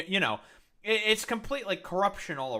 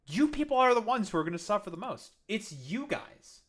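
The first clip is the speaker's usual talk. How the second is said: pointed scolding; 8 semitones higher, 5 dB louder than usual. Real, not acted. Lecturing Trump supporters in chat.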